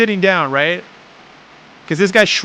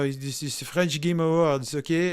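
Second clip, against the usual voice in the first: a light, quieter mock French accent; 9 dB quieter than usual. Streamer jokes about the French Game Awards in a light French accent. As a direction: play a French host teasing in a French accent, lightly. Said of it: "That's why I can do a French accent".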